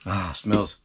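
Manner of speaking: normal talking voice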